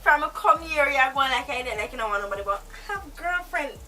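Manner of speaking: usual speaking voice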